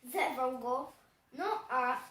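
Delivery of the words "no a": ordinary delivery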